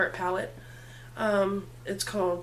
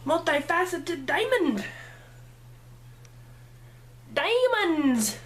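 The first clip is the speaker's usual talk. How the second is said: higher, drawn-out gleeful cry; excited exclamation; 7 semitones higher, slower than usual. Real, not acted. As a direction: sing out the sparkle like a prize. Excited about the shimmer.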